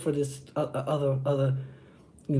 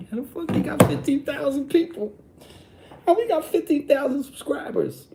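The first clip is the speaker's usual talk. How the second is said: mocking jealous YouTuber; 10 semitones higher than usual. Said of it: "Probably another YouTuber mad as fuck".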